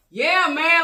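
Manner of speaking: loud, high exasperated burst; retold frustration. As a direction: act out his mounting frustration. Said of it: "he's getting frustrated".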